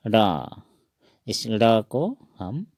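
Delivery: usual speaking voice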